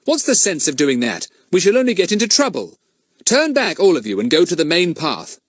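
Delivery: Fatty furious at Ern, pitch up; angry boy. A narrator's reading. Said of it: "said Fatty angrily".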